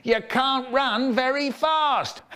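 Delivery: high, stretched-out jeer; taunting shout. A narrator's reading. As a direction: jeer back at the slow pursuer. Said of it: "she shouted".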